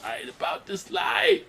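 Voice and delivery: high, stretched-out cartoon yell; hammed-up high wail, dragged out; mock panicked cry. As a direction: cry it out in exaggerated falsetto despair. Same quote repeated as cartoon panic.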